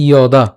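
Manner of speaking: "যদা" begun with a clear y glide, like "iyo-da"; initial y sound. Shown as a demonstration. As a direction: say "যদা" with y at the start, never j. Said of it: "ইয়র মতো হবে".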